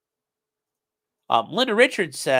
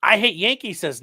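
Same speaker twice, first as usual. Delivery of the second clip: ordinary talk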